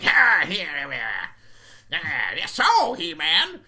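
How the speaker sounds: loud, raspy villain growl; raspy repo man voice. Retold or imitated. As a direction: growl it like a hammy slasher. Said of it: "His voice gets all raspy".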